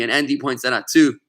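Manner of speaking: ordinary delivery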